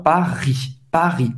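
PARIS with a throaty French R; guttural R. Shown as a demonstration. Teacher demonstrating French R, guttural in PARIS. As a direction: gargle the R in PARIS. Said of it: "o R é bem gutural".

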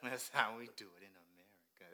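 low, soft impression through laughter; amused low catchphrase parody; Bandit Keith. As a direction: drop the pitch and drag out the catchphrase. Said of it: "he be like, he be like".

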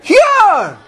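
high, loud exclamation, falling away; emphatic preaching. Real, not acted. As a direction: call out the answer to the congregation. Answers his own question emphatically.

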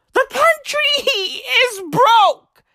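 high, drawn-out wail; mock outburst. Comedian explaining why people need booze, mock wail. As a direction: wail it out slowly in mock despair.

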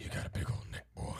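hushed, whispery impression of another man; imitating the man. Imitating the man sizing up a collar, whispery. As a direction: drop to an unvoiced, creepy whisper. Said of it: "do not tell me that is what he sounded like".

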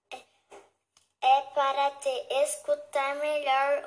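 disguised voice. Acted. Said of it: "disfarçando a voz".

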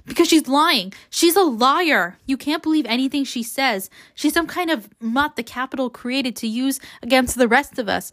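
high-pitched angry acted shout. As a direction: raise pitch and voice in accusation, easing at the end. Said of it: "Peeta shouts".

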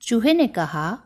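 plain reading voice

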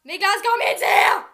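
loud call across rooms. Real, not acted. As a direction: shout to someone far off. Calling to another room.

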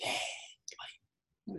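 hushed, whispered disbelief; barely voiced reaction; stunned whisper. Retold stunned reaction to the death.

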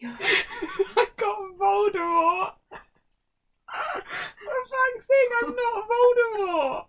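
high, loud, drawn-out laughing wail; outraged disbelief. Real, not acted. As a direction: wail in real dismay through laughter. Outraged at own quiz result.

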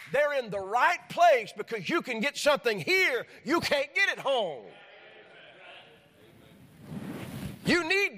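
high-pitched, impassioned sermon delivery; fervent preaching. Real, not acted. Preacher telling the congregation they need church, preaching fervently. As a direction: preach it hard, voice lifted high with conviction.

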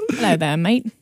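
attempted British that lands Australian; playful Aussie greeting, accent on "mate". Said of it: "That's Australian".

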